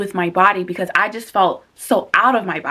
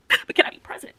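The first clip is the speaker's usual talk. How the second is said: whisper; 3 dB quieter than usual. Acted. Asking herself, whispered then voiced.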